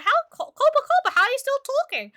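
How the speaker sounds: very high puzzled viewer voice; high squeaky viewer. Pretend. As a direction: squeak it like a baffled fan. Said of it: "you'd be like".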